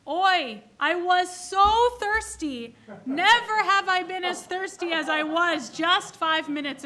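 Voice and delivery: put-on milk the complaint like a suffering old man; loud kvetching. Thirsty man grumbling after his water, hammed-up kvetch.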